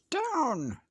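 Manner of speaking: high, loud cry of Down; witch crying out. A narrator's reading. Witch yelling to her cat to land. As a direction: shout it like a startled witch. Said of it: "cried the witch".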